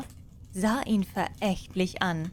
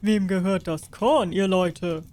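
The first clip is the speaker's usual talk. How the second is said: regal question, a touch louder; lightly voiced king; 4 dB louder than usual. King asks the peasants about the hay, a hint regal.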